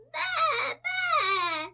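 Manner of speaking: put-on child bleating as the sheep. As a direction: bleat like a little lamb. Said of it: "says the sheep".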